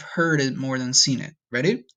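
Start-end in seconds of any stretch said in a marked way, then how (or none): none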